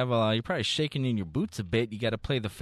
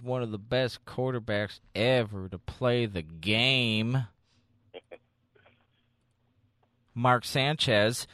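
mock dramatic reveal; slower than usual. Mock hype for bad player.

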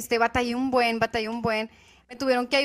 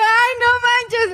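mock wail; 10 semitones higher, 8 dB louder than usual. Retold embarrassed reaction.

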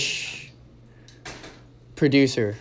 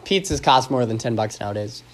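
usual speaking voice

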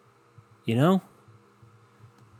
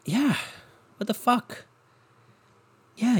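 normal talking voice

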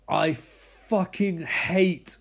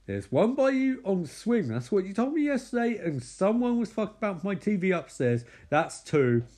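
insistent Mike; 6 semitones higher than usual. Retold or imitated. Mike arguing, voiced.

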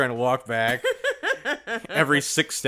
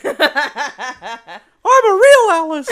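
high, loud, slow puppet voice; Pinocchio voice; 14 semitones higher, 11 dB louder, much slower than usual. Acted. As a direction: stretch it out like a wooden boy. Voicing Pinocchio line.

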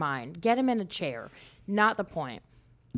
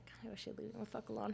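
much softer and fast private gripe; inner grumble; 16 dB quieter, faster than usual. Retold or imitated. About a chatty coworker, quick quiet grumble. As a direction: mutter it under your breath. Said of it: "and I was like".

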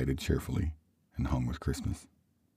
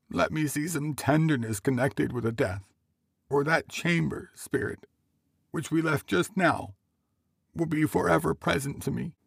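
pleading man; 12 semitones higher than usual. Acted. Man begging the spirit for some tenderness, high plea.

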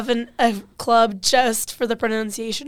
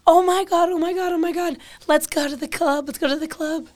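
high-pitched, giddy pleading; imitated excited squeal, higher than usual; excited club-goers; 6 semitones higher than usual. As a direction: mimic friends excited to go out. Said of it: "I'd hear".

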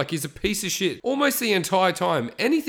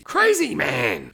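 disbelief; slower than usual. Real, not acted. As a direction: throw out Crazy in sharp disbelief. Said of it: "It's crazy".

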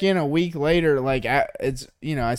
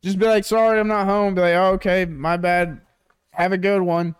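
tag; 6 semitones higher, 4 dB louder than usual. Acted. Acts out wished-for doorstep exchange.

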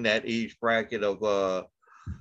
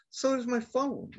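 high sarcastic retort; 13 semitones higher than usual. Retold or imitated. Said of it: "And I'm like".

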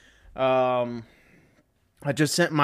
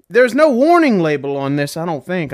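ordinary delivery

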